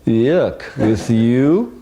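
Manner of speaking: retold appalled rejection, drawn out and rising; disgusted. Woman refusing a phone proposal, disgusted. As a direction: imitate her recoiling at the very idea.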